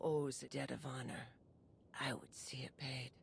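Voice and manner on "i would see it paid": a cold, hushed whisper of vengeance; whispered vow. Acted. Akavi swears Hedda's debt will be paid, whispered. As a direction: breathe the promise under the breath.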